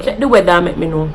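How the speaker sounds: weather said "weda", no th; lightly voiced Jamaican "weda"; Jamaican patois. Shown as a demonstration. YouTuber acting out a Jamaican asking about the weather.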